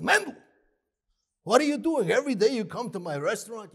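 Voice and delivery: high, loud, exasperated scolding; acted indignant outburst; agitated calling out. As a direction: call out to a missing regular, high and loud. Worried boss accosts missing regular.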